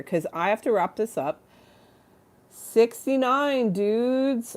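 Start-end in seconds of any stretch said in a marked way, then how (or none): 2.5-4.6 high, drawn-out dude voice; dude catchphrase. Acted. Comic quoting movie dudes, slow high goofy cheer. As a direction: stretch it out slowly, pitched high.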